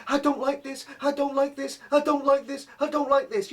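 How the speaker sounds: high, frantic repeated chant; mum going mental. Retold or imitated. Mum breaking down at her son, high frantic mimicry. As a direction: imitate mum having a meltdown, without full shouting. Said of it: "going mental on me, shouting out".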